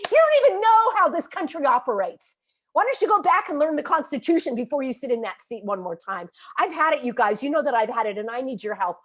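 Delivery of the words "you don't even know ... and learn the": higher and louder, real exasperated shout; furious outburst. Real, not acted. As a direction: blast them for not knowing the basics. Scolding officials at peak anger.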